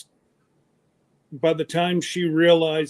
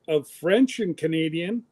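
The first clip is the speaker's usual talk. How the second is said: normal talking voice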